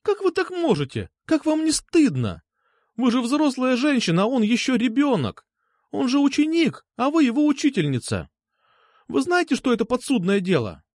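put-on high-pitched girl scolding an adult; outraged, tearful schoolgirl's tirade; indignant accusation. Distraught girl berates her teacher.

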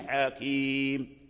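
حكيم drawn out very slowly, ending on a held m with no vowel; pausal stop. Shown as a demonstration. Teacher demonstrating the pause on حكيم, slow drawn-out stop. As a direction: stretch حكيم and stop on the bare m. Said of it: "فنقف بالسكون".

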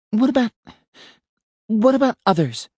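high, pleading repeat; urgent. A narrator's reading. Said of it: "almost shouting".

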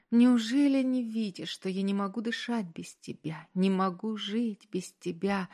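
ordinary delivery

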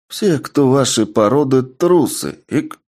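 put-on drunken jab ending in a hiccup; tipsy hiccup. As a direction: sneer it, then hiccup. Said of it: "добавила Аретто".

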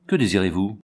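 ordinary delivery, nothing marked

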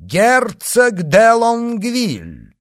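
loud, high, drawn-out announcement; громкое объявление лакея. A narrator's reading. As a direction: announce a grand guest like a footman, slowly. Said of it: "доложил громким голосом". (громкий протяжный доклад лакея)

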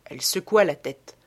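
usual speaking voice

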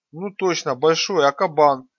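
put-on ringing young voice. A narrator's reading. Ringing voice calling out.

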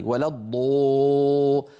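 الضو with pursed lips, vowel pulled to o; rounded emphatic. Shown as a demonstration. A teacher imitating overrounded emphasis. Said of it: "فيزم ويضم شفتيه".

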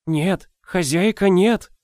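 put-on high, slow frightened old woman; urgent old nurse pleading; old woman whispering. A narrator's reading. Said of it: "прошептала старуха". (шёпот старухи)

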